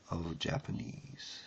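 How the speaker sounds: normal talking voice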